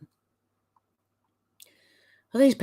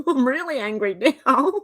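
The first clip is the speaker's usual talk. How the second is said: laughing; 7 semitones higher than usual. Real, not acted. Laughs at own anger.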